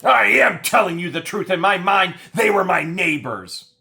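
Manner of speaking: high, loud indignant mock voice; mocking woman. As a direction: play her whiny and defensive. Mocking her insistence.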